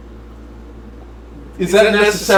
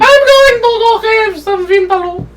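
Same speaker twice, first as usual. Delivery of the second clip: high put-on Russian accent; Russian woman voice; 13 semitones higher than usual. Cohost voices the cave woman in a high Russian accent. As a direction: play the Russian woman, pitched up. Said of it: "I'll just be all the characters".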